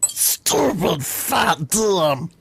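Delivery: very high, very slow creature shout; Gollum shout. Retold or imitated. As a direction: snarl it out slowly like Gollum.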